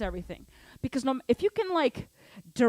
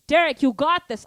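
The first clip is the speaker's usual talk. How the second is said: encouraging call; 4 semitones higher, 9 dB louder than usual. Real, not acted. Cheering on student Derek.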